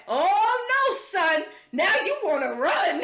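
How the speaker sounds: hammed-up old man hollering a warning; high, loud "oh", breaking into laughter. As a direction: imitate an alarmed elder calling out. Old man warns the stranger.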